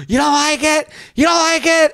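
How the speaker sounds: shrill-free but high, loud imitation of an exasperated mom; mom yelling. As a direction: mom scolding her kid at Bloomingdale's. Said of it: "her yelling at me".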